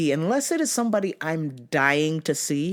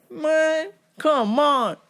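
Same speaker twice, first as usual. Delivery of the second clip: wheedling plea; 8 semitones higher than usual. Retold or imitated. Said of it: "I was like".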